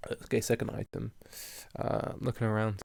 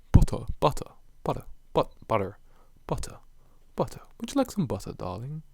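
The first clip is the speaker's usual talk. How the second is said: practising posh British vowels; mock upper-class British, pitched up; high-class British accent; 4 semitones higher, 6 dB louder than usual. Shown as a demonstration. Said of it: "A bit high class British".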